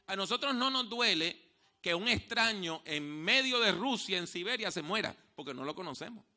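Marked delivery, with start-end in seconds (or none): none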